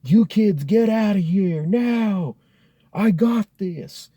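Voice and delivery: slow, loud, high-pitched order; drawn-out commanding shout, taking charge. Retold or imitated. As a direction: act out a neighbor yelling kids away. Armed neighbor ordering the kids away.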